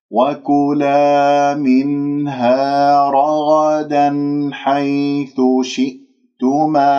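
very slow, sustained recitation; demonstrated tajweed recitation. Shown as a demonstration. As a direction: stretch each long vowel and hum the noon. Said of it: "أحرص على المدود الطبيعية". (ترتيل تعليمي بطيء)

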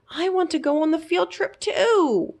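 high pleading mouse voice; longing little mouse. A narrator's reading. Mouse left out wishing to go, high pleading. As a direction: wish it aloud in a tiny high voice. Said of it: "Fergus thought".

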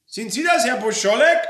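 high, loud shouted question; incredulous, accusing yell; calling out. Acted. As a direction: challenge the hidden speaker. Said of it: "Dann rief Frau Roller".

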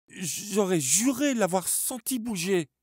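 high, shaken stammer. A narrator's reading. Charlie insisting to his friends, stammering. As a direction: stumble over the words. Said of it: "bégaya-t-il".

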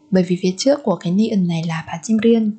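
usual speaking voice

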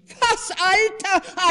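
high, loud, slow affronted cry; indignant outcry. A narrator's reading. The wife is offended at her husband's remark, indignant cry. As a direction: bristle at the word. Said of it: "rief die Frau".

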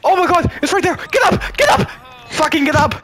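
panicking gamer. Real, not acted. Begging downed teammate to rise.

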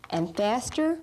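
ordinary talk, nothing marked

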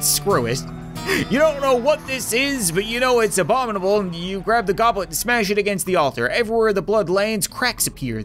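ordinary delivery

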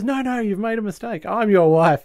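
high-pitched imitation of a wife butting in; mock eager protest. Mother claiming the stranger as husband, high acted protest. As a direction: cut in with a high, put-on claim. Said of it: "Peg jumps up and says".

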